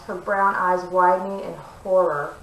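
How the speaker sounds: ordinary delivery, nothing marked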